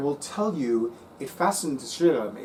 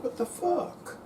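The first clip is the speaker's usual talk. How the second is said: shocked reaction; 13 semitones higher, 6 dB quieter, slower than usual. Retold or imitated. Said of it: "And I'm like".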